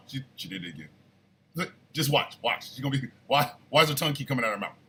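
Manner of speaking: low, very soft imitation of a wife; conspiratorial aside under the breath; wife's hushed commentary. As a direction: murmur it low and quick, urging him to watch. Imitating wife's quiet running commentary.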